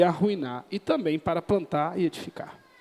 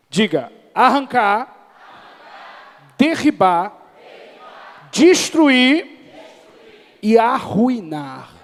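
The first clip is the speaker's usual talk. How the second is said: louder, fervent churchgoer call; believer's voice; 2 semitones higher, 6 dB louder, much slower than usual. Shown as a demonstration. Pastor leading the congregation in a fervent believer's voice. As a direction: say it like someone headed for heaven. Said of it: "com voz de crente agora".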